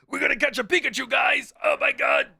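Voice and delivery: high, loud, put-on excited shout; hammed-up hype yell. Costumed streamer screaming on the sidewalk, high and loud. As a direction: scream it like a cartoon fan. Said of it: "I'm just screaming on the sidewalk".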